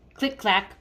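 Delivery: put-on lively read-aloud voice for kids; storybook reading. Teacher reading a picture book to her class, lively.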